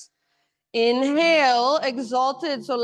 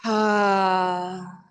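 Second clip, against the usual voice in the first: open-mouthed audible sigh; big sigh; 8 semitones lower than usual. Real, not acted. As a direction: let out one long sigh. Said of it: "open your mouth and take a big sigh".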